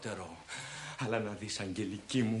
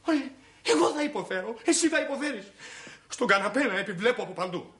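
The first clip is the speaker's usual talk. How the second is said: mock suffering; 8 semitones higher, faster than usual. Husband mocking wife's complaint.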